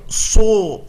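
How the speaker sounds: su said with pushed-out rounded lips; wrong pouting su. Shown as a demonstration. Teacher shows the lip-rounding mistake, then says no.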